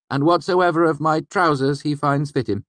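high, grandly generous dictation; magnanimous. Acted. Young man leaving boots to employer, magnanimous. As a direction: bequeath it with lofty generosity. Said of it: "Bast continued magnanimously".

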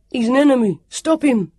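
loud, high urgent shout. A narrator's reading. Sam shouting at the others to stop the warrior. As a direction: yell to the others to stop him. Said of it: "Sam shouted".

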